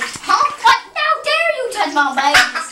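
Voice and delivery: high, indignant mock protest; indignant duck. Voicing Peeps scolding them for touching the babies, indignant. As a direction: play an offended, protective parent. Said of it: "Peeps is like".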